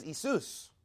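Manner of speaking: Isus said ee-SOOS; Greek pronunciation. Shown as a demonstration. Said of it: "In fact, in Greek".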